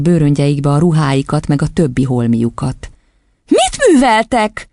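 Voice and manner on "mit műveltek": put-on high, drawn-out little-girl outcry; high-pitched childish exclamation; child's cry. A narrator's reading. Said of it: "kiáltott a kislány".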